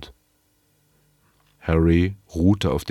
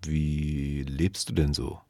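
slow, hesitant and softer; 5 dB quieter, slower than usual. Acted. Snout hesitantly asks Kelvin over the videophone, slow and soft. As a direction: ask it haltingly, unsure of the answer. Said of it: "äußerte er sich mit einigem Zögern".

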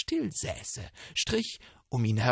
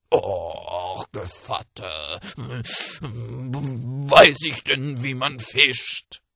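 whining wolf; 7 dB louder, much slower than usual. Acted. Wolf pleading ignorance.

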